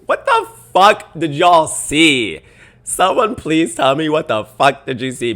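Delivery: loud, high, indignant demand; fed-up, incredulous shouting; exasperated outburst. Real, not acted. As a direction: yell it in disbelief, stretching the words. Exasperated challenge to the commenters.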